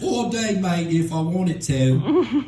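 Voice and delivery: slow, drawn-out mock Cockney; Cockney accent. Shown as a demonstration. Comic showing he can be British, hammed-up Cockney accent. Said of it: "talk with a Cockney accent".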